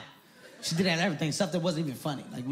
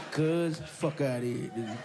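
thug dude holding in a laugh. Retold or imitated. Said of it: "he like".

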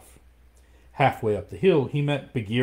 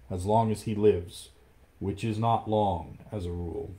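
ordinary delivery

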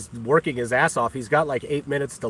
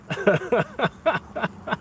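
high giggle; 9 semitones higher than usual. Real, not acted. Laughs at own rant.

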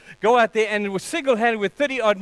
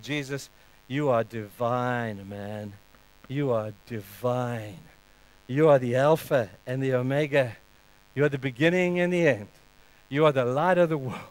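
tender reverence; 7 semitones lower, 4 dB quieter than usual. Acted. God speaking to Jesus, soft, low and tender. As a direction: speak it like a hushed blessing.

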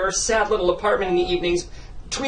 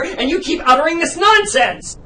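raised, exasperated voice; angry shout; 6 semitones higher, 3 dB louder than usual. Acted. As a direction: shout at her in frustration. Director losing it.

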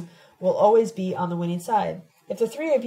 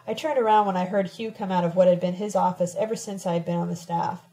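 ordinary delivery

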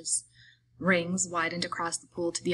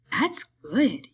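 put-on childlike, contented big man, pitched up; happy simpleton; 4 semitones higher than usual. A narrator's reading. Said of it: "He smiled happily".

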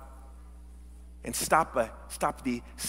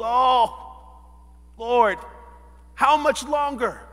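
high, loud cry to God; anguished plea; 5 semitones higher, 3 dB louder, slower than usual. Acted. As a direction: plead upward in mock despair. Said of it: "when we see all the atrocities".

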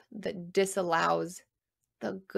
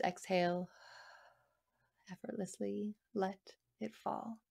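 guided meditation; 4 dB quieter than usual. Acted. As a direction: soothe them through each breath, very softly. Leads a breathing meditation.

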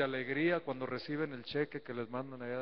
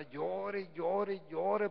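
high, whiny mock weeping "llore, llore"; mock wailing daughter-in-law; 5 semitones higher, much slower than usual. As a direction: mimic fake tears at a funeral. Mocking the crying daughter-in-law.

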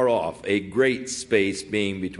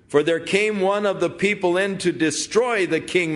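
yelling; 9 semitones higher than usual. Acted. David shouting rebuke at Abner across the distance. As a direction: call out the accusation across the valley. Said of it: "He started yelling".

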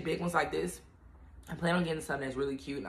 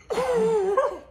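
high burst of laughter; 6 dB louder than usual. Real, not acted. Overwhelmed laugh.

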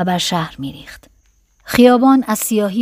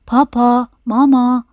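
mechanical doll call, pitched up; talking doll; 4 semitones higher, 4 dB louder, slower than usual. A narrator's reading. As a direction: say it like a toy doll's two-word recording. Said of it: "باز عروسک حرف زد".

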